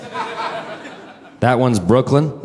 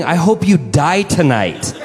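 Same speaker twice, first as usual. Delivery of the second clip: slow, deliberate curse; slow curse repeated; 6 semitones higher, slower than usual. Retold or imitated. Repeating her curse to the audience, slow and deliberate. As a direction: let each word land slowly. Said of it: "Not even kidding".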